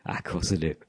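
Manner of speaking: meek answer. Retold or imitated. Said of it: "Steve's answer".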